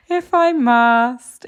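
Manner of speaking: slow, loud, sighing reluctance; reluctant horse. Retold or imitated. Horse voiced as grudgingly agreeing, slow sigh. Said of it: "He's like".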